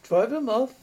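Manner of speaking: rising, incredulous echo of Drive 'em off; disbelieving echo, lifting on 'em; asked in disbelief. A narrator's reading. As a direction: throw the words back as a doubting question. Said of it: "Richards asked".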